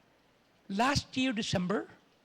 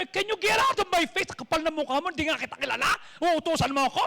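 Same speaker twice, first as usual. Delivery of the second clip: mock-outraged, higher-pitched comeback; indignant retort; 4 semitones higher, faster than usual. Widow imagined refusing Elijah's order, indignant. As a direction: snap back at a presumptuous stranger.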